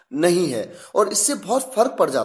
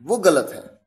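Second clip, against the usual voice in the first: ordinary talk, nothing marked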